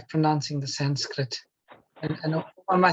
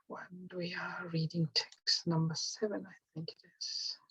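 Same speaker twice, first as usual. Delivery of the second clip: whispering; 14 dB quieter than usual. Real, not acted. Muttering to himself while searching.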